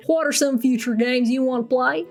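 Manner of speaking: put-on Southern US accent. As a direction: ask it with a mock Southern twang. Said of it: "good old Southern accent".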